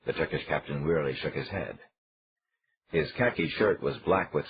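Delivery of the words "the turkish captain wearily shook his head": usual speaking voice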